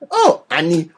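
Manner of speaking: high, garbled little alien; little Greedo. Acted. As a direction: badly imitate a tiny alien kid. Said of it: "Little Greedo".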